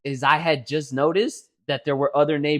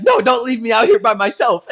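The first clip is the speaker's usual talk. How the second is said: higher, louder protest; mock pleading yell; 6 semitones higher, 7 dB louder than usual. Kid begging not to be left outside, mock-alarmed yell.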